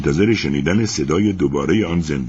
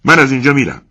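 much louder angry outburst; angry shout; 7 semitones higher, 9 dB louder, faster than usual. Acted. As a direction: storm off shouting. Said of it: "من عصبانی شدم و فریاد زدم".

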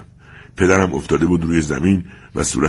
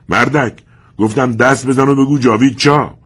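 loud, much higher furious repeat; angry shout; 8 semitones higher, 6 dB louder than usual. Acted. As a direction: yell the order again, furious. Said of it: "فریاد زد".

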